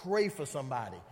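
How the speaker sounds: low, hushed inner prompting; voice of God. Acted. God nudging a drained believer to pray, low and hushed. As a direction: speak low and soft, as God's quiet nudge. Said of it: "you hear a voice that says".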